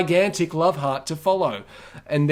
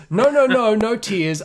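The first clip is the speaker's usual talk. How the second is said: high, loud no no no; hammed-up eager denial; eager protest; 7 semitones higher, 4 dB louder than usual. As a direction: rush to deny it, pitched up and loud. Scammer insisting eagerly.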